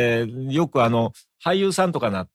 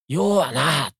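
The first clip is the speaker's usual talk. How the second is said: louder, higher Osaka-dialect impatience; imitated irritation; 5 semitones higher, 4 dB louder, much slower than usual. Boss stepping in as his junior fumbles in negotiation, imitated irritation. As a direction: mimic an irritated boss cutting in. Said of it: "イライラしてきてですね".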